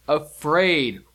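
ordinary talk, nothing marked

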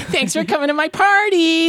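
put-on high, bright girly character voice; Belinda voice. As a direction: chirp it like a bubbly girl. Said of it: "the Belinda voice".

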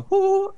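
high falsetto whoop. Retold or imitated. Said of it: "taught [name] how to scream like [name]".

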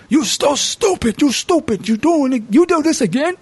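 high, put-on scolding voice; mock-exasperated brothers, voiced high. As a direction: pitch up and nag him.

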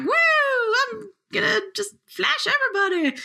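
high, whooping goofball voice; wacky person. Retold or imitated. Imagined oddball off the trail flashing the crew, wacky whooping voice. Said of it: "like, this wacky person".

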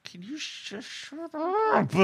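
high, hushed exasperated snap; mock snap. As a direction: hiss at mom to shut up, mock-annoyed. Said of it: "And I'm like".

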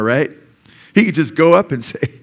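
usual speaking voice

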